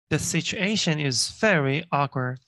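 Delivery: very slow, every word clear; slow model reading. Shown as a demonstration. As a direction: read it word by word for learners. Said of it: "teacher reading slowly".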